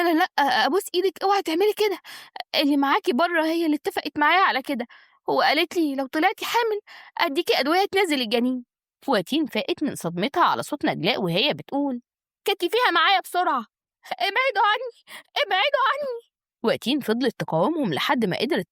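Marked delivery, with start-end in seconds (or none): 0.0-8.6 high-pitched frightened begging. A narrator's reading. Doctor begs patient not to report her, frightened. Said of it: "الدكتورة بخوف شديد". 12.4-13.7 high, urgent command; urgent order. Acted. Naglaa tells doctor to restrain Wateen, urgent. 14.1-16.3 panicked cry. Acted. Patient fights off two captors, panicked. Struggling against attackers.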